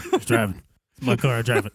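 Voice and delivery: put-on low, soft, unbothered old driver; low elderly voice, unmoved by panic; old man. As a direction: play a stubborn old man, quietly. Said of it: "ninety fucking year old man".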